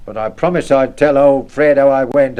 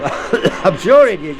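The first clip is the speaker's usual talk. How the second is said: laughing; 3 semitones higher, much slower than usual. Acted. Laughing while being sprayed.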